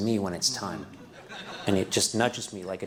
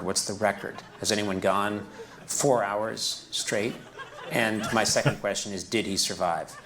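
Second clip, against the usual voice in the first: usual speaking voice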